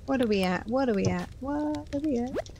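normal talking voice

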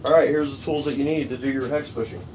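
ordinary delivery

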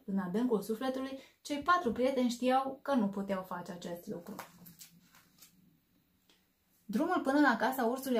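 put-on lively, animated read-aloud for kids; expressive bedtime story narration; storybook reading. A narrator's reading. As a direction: bring the story alive for little listeners. Reads picture book to children.

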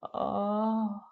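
a sobbing "ah" of despair; crying wail. Acted. The rejected dish cries out to the audience, sobbing. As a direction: let out a tearful cry.

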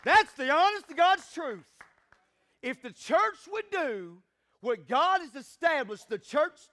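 high, loud preacher's proclamation; full-force preaching. Real, not acted. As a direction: exhort the congregation forcefully, pitch lifted.

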